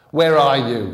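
loud, raging shout; furious growl. A narrator's reading. Gunman searching for the vanished boy, furious shout. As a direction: bellow it in fury. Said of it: "he growled".

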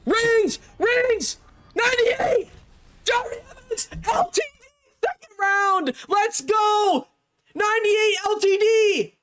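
high-pitched, ecstatic yelling over a lucky pull; excited shouting. Real, not acted. Gamer celebrating a ninety-eight pack pull, real excited shouting. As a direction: yell out in real, breathless celebration. Pulls rare card from pack.

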